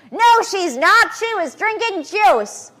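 high, slow, loud mocking purist voice; natural hygienist accent. Mocking a natural hygienist who scolds the lecturer, high and slow. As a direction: lampoon a strict natural hygienist scolding her. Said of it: "That's my natural hygienist accent".

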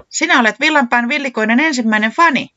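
high, loud delighted exclamation; overjoyed. A narrator's reading. Säämies greets the band's first fan, overjoyed. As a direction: rejoice loudly at a first fan. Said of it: "iloitsi Säämies".

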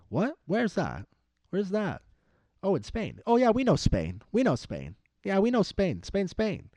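high-pitched, mock-baffled European; exaggerated high cluelessness, brushing it off; clueless incredulity. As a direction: play oblivious outsiders, pitch leaping way up. Acting Europeans clueless about Catalonia.

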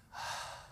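breathy unvoiced ah of relief; relieved sigh. Believer feeling relief, a soft sighed ah. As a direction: let the weight drop off in a sigh. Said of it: "y dices".

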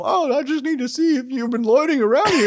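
high, loud deputy voice; hammed-up squeaky sitcom cop; mock nervous cop. Cop questioning a loiterer, high mock voice.

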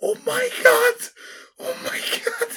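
very high shocked exclamation; panicked cry. Retold or imitated. Teacher seeing the burned scalp, high panicked shriek. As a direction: shriek it in horror.

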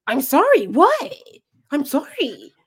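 high squeaky mock apology; a hammed-up whiny student saying sorry. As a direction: parrot him in a high put-on voice. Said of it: "mocking the student's reaction".

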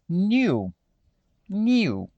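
"new" said "nyew" with a y-glide; British "new" with a y-glide; British pronunciation. Shown as a demonstration. Teacher contrasting British with American for students. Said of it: "a pronúncia do britânico".

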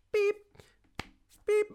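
short high electronic beep; imitated beep. Contactless card tapped on jet wash machine, imitated beep. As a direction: mimic a card reader's little beep. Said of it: "it's like a little".

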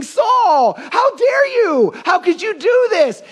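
very high, drawn-out indignant cry; mock outrage. Preacher acts out what Jesus is not thinking, shrieked indignation. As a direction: stretch "how dare you" into a scandalised wail. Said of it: "He's not here thinking".

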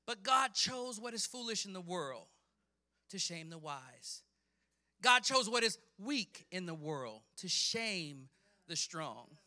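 loud proclamation, easing at phrase ends; fervent scripture preaching. Acted. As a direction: declaim the verse with conviction.

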